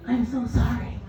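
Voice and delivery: soft, mock-solemn sympathy; dramatic condolence. Nurse phoning a wife about her husband, dramatic condolence. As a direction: offer a softly hammed-up apology. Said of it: "I was being all dramatic".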